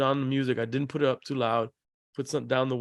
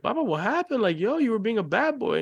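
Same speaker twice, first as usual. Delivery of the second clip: mock gentle scolding; 9 semitones higher than usual. Father scolding son gently.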